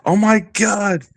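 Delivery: high, slow drawn-out oh my god; girl behind him reacting to the urinating man, high and slow; girl's shocked gasp. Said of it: "this girl behind me going".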